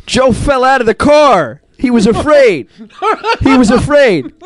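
high, drawn-out mock wail; mock panicked outcry. Acting out Billy's alarmed outcry.

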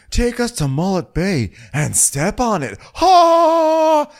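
high, slow goofy command; hammed-up reveller impression; Shep. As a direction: order it grandly, ending on a loud high "Ha!". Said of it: "And Shep is like".

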